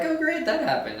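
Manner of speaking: high, sing-song "oh great"; high, sing-song sarcasm; mock sarcastic. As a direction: toss it off high with mock surprise. Waking mid-audiobook, confused.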